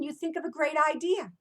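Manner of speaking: normal talking voice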